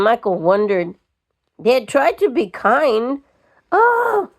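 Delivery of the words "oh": shocked gasp. A narrator's reading. Anne gasps at Mattie.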